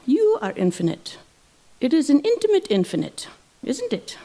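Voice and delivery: high-pitched, swooping mock Indian guru accent; ridiculous Indian accent. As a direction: teasingly preach in an exaggerated Indian accent. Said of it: "the most ridiculous Indian voice, Indian accent".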